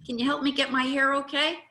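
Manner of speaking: high, bright, loud agreeing; sing-song happy admission; cheerful agreement. Shown as a demonstration. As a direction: gladly own the truth. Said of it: "you say".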